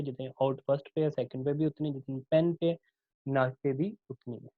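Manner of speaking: normal talking voice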